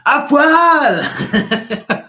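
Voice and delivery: high, loud whooping shout through a laugh; drunken party yell. Retold or imitated. Mimicking a French friend shouting at the club, loud laughing yell. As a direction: belt it like a drunk friend on the dance floor. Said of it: "dancing and saying".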